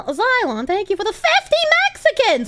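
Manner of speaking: a cute tantrum, quick and high; angy voice. Pretend. Streamer reading a donation in a cute mock-angry voice. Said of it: "in my most angy voice".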